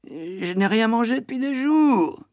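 put-on very high, wailing child voice; plaintive whining. A narrator's reading. Boy pleading his case to the matron, whining. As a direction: whine it out pitifully.

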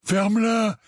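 high, irritated cut-off; growled. A narrator's reading. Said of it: "grogna Dudley". (grognement irrité, voix aiguë)

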